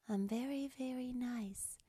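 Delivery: soft, breathy whispered sweetness; whispered sugary nice. Shown as a demonstration. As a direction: coo it very nicely. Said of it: "extremely nice exercise".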